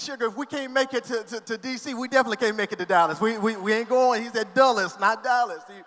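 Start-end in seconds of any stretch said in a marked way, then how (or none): 0.0-4.2 loud, fast, laughing reply to his wife; laughing retort. Retold or imitated. Husband answers panicked wife laughing. 4.5-5.6 loud, punchy correction. Retold or imitated. Correcting the wife's mix-up.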